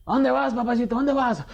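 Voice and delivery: hammed-up police taunt, mildly mocking; taunting cop. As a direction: needle him like a corrupt cop.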